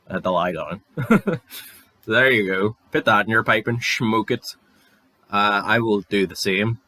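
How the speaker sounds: normal talking voice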